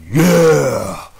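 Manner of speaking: loud, high mock demon cheer; a crowd of demons shouting in triumph; demon roar. Dreamer imitates thousands of demons cheering, loud high yell.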